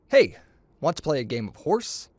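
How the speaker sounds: ordinary delivery, nothing marked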